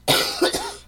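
a loud, rough cough; chesty cough. Real, not acted. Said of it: "This is starting to bug me, this throat of mine".

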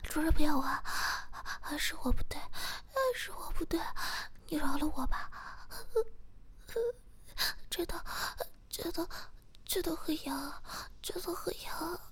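a whispered sobbing plea for mercy; crying plea. Acted. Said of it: "并哭着求饶道".

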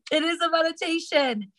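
can you believe it, laughing it off; amused disbelief. Real, not acted. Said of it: "I'm not even kidding".

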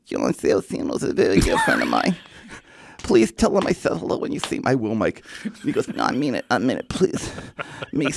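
mild imitation of Tyson, pleading and emotional; lightly voiced Tyson begging; muffled hug-bound Tyson pleading; Mike Tyson impression. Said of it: "I hear muffled".

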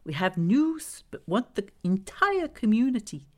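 high, pointed character voice; insistent Glenda character. Acted. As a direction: press the point in a lifted, lilting voice. Glenda's voice in narrated story.

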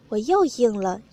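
plain reading voice

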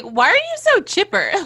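high, loud teasing disbelief; incredulous. Retold or imitated. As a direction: tease him for being too cheerful. Mariner mocking Rutherford's cheer.

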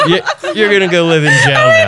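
high shout through laughter; laughing shout. Real, not acted. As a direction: yell it while cracking up. Laughing mock accusation.

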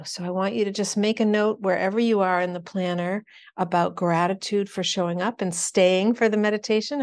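usual speaking voice

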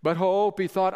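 ordinary delivery

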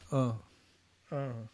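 imitating a dejected crew; a low, weary little sigh of a word; despondent groan. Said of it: "sat with their heads in their hands".

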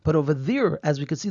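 usual speaking voice

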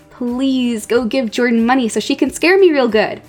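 ordinary talk, nothing marked

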